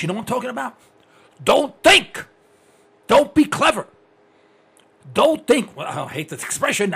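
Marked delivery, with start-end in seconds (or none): 1.4-5.8 loud, high mock orders; emphatic, raised-voice prohibition; barked commands. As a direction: bark it like a drill-happy teacher. Acting out schools' commands to kids.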